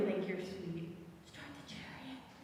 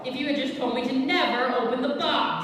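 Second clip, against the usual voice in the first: angry shouting; 9 dB louder than usual. Acted. Shouting quarrel over the box.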